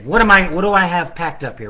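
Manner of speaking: normal talking voice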